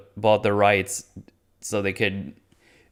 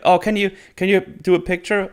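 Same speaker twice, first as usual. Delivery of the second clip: high, louder put-on fan voice; eager fan; 11 semitones higher, 5 dB louder than usual. Fan asks Titania for a picture, gushing mock-eager. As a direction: imitate a fan pitched way up, asking a favor. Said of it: "some fan of Titania goes like".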